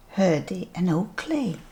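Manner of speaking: ordinary delivery, nothing marked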